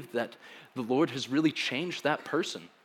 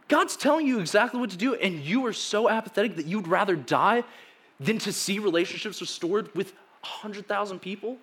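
exasperated disbelief; 7 semitones higher than usual. Real, not acted. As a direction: throw up your hands at Jonah, pitch flying up. Preacher exasperated at Jonah.

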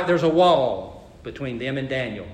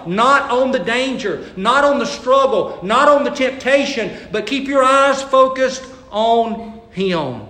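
louder, higher, driving each line home; forceful preaching; 7 semitones higher, 5 dB louder than usual. Acted. Preacher urging the congregation not to fear, forceful preaching. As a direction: preach it hard, hammering the point.

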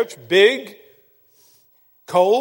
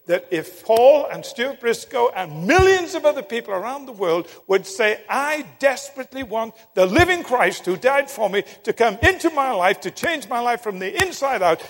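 raised, louder voice building to "he will"; impassioned preaching; 5 semitones higher, 6 dB louder than usual. Real, not acted. Preacher on asking Christ into one's life, impassioned. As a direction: drive it home with mounting force.

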